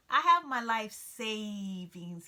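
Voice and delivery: slow, pitiful plea; pathetic voice. A narrator's reading. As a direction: play it small and sorry for yourself. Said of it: "he said in a pathetic voice".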